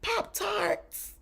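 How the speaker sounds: high, soft mock squeal; imitating excited child. Mother imitating her child spotting Pop-Tarts, excited little squeal. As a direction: mimic a kid's delighted cry, way up high but quiet. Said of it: "but he was like".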